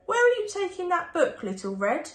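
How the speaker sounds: plain reading voice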